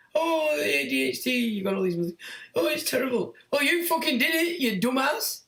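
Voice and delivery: high, put-on voice of a fretting parent; mocking whiny parent. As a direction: lampoon the fussing mum, then snap.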